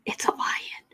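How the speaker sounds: whispered, playful menace; mock spooky whisper. Host teasing viewers about the lion hint, whispered. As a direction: hush it like a campfire secret.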